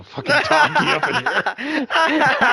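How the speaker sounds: high, loud laughing outburst; laughing exclaim. Real, not acted. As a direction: burst out laughing at the sight. Donkey walks across stream screen.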